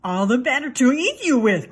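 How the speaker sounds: loud growling big bad wolf; growled. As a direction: growl it out as he pounces. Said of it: "growled the wolf".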